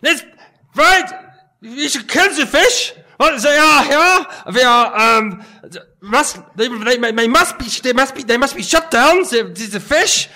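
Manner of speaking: loud, high ranting dictator with mock German accent; hammed-up shouted tirade, stumbling over the words; Adolf Hitler. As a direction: bark it in bursts like a raving demagogue. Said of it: "in the style of Adolf Hitler".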